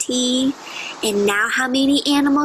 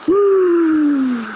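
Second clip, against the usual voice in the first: loud blown-out whew of relief; relieved whew; 3 semitones higher, 5 dB louder than usual. Acted. Teacher reading to children, a big loud relieved whew. Bear stays asleep, relief.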